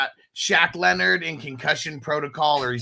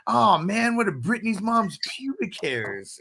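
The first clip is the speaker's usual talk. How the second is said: acting movie character; 7 semitones higher than usual. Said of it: "and he's like".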